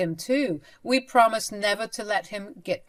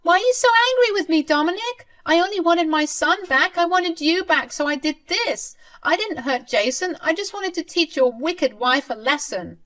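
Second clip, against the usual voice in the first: whining grandmother; 11 semitones higher, 6 dB louder than usual. Acted. Grandmother whining back at angry son.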